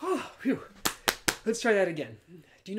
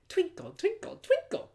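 high sing-song; 5 dB louder than usual. Shown as a demonstration. Bright sung twinkle after whisper.

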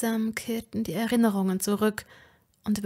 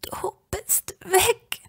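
slow, breathless whisper near tears; tearful whisper; 10 semitones higher, much slower than usual. A narrator's reading. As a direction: whisper it slowly, almost crying. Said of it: "flüsterte ich".

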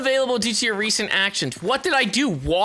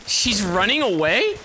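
ordinary talk

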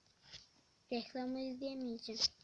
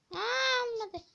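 high loud Aa falling into olmadı; real dismay, pitched high; dismayed cry; 9 semitones higher, 6 dB louder, slower than usual. Real, not acted. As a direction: cry out as the move fails. Move fails unexpectedly.

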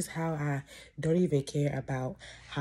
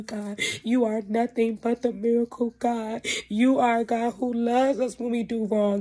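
higher, louder impassioned prayer; fervent prayer; 4 semitones higher, 4 dB louder than usual. Acted. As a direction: build the prayer to a passionate cry. Prayer building to fervor.

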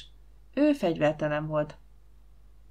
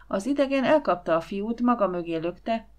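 ordinary delivery

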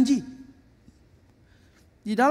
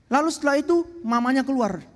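normal talking voice

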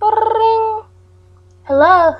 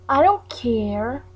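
ordinary delivery

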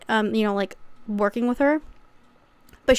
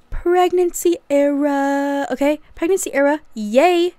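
higher, bright mock celebration; mock cheer; 4 semitones higher, 3 dB louder, slower than usual. Vlogger announcing the pregnancy era with a mock cheer. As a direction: cheer it like a party.

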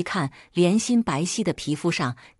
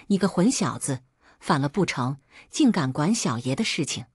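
usual speaking voice

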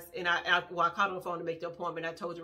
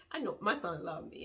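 ordinary talk, nothing marked

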